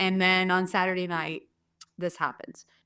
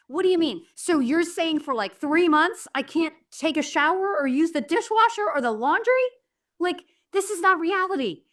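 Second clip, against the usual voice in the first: high, pleading disbelief; panicking; 6 semitones higher than usual. Retold or imitated. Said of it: "so I'm panicking".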